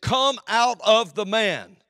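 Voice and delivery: slow, loud and high command; commanding shout. Acted. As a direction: order the spirit out. Jesus commanding the spirit.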